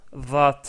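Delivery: "vat" with a firm, emphatic first sound; Arabic letter sound. Shown as a demonstration. Teacher stressing "vat" so learners hear the sound. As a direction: press hard on the start of "vat". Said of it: "dans le mot vat".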